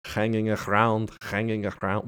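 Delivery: slow mock Russian accent. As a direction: draw out the words in a Russian lilt. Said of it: "Malkovich's fake Russian accent".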